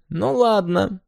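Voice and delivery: high-pitched, warning okay; threatening. A narrator's reading. Hanna about to climb the railing, threatening in a high voice. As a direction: make it a teasing threat. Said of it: "с угрозой в голосе".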